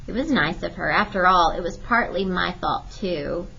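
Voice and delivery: ordinary delivery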